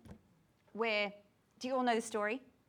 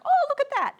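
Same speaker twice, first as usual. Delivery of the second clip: much higher and louder, sudden delight; delighted discovery; 10 dB louder than usual. Retold or imitated. Teacher reliving spotting the pattern, excited surprise. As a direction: gasp it out as if spotting treasure. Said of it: "I was like".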